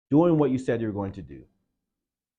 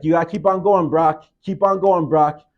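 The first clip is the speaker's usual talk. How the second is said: loud, high coach shouting encouragement; coach yelling; 6 semitones higher, 9 dB louder than usual. Retold or imitated. Coach shouting at his player to keep crawling. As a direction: drive him on, louder each time.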